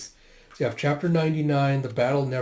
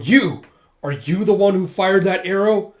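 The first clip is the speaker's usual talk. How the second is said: loud, raised soldier's challenge; angry challenge; 6 semitones higher, 8 dB louder than usual. Retold or imitated. Soldier challenging whoever fired the arrow, loud and angry. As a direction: accuse them loudly.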